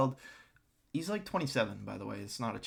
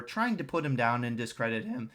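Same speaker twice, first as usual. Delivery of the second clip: normal talking voice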